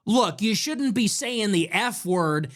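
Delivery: usual speaking voice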